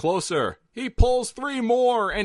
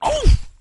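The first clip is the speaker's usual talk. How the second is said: winded grunt; 8 dB louder than usual. Acted. Old man knocked backward.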